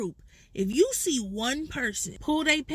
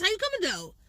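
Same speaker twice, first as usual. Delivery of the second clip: playful challenge, pitched up; spades table trash talk; 6 semitones higher, faster than usual. Shown as a demonstration. Playing spades, needling the other players, cocky taunt. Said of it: "Okay, I'm coming like this".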